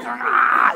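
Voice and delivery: loud, high hammed-up wail; imitated ugly-face crying cry; mock ugly crying. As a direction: burst into an exaggerated bawl. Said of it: "the ugly face crying where you're like".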